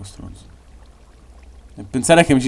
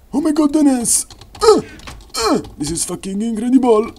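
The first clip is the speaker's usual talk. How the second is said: excited; 12 semitones higher than usual. Real, not acted. Excited outburst in English.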